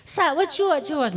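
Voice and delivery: prissy book-Gujarati impression; mock over-educated visitor. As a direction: ham up a city visitor showing off his refined speech. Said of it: "Gujarati આમ ચીપી ચીપીને બોલે".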